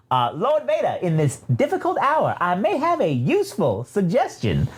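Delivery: high, swooping droid voice; high-pitched, theatrically polite villain droid; evil droid. Acted. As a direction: play a scheming droid offering advice. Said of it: "evil ass triple zero pops in and says".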